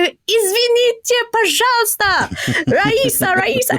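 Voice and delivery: retold scream in Russian, pitched high to grab her attention; yelling in Russian. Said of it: "yell and scream in Russian to attract their attention".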